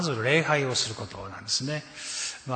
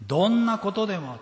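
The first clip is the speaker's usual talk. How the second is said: どんなことでも thrown out high and loud; stress on どんなことでも; 7 semitones higher, 4 dB louder than usual. Shown as a demonstration. Preacher repeating the key phrase, high and loud. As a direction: call out どんなことでも with force. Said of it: "preacher repeats key phrase".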